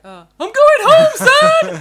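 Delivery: high, full-volume mock holler; mock triumphant shout. Joking about Cage's line to his despairing mate, mock shout. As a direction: belt it out over your poor friend.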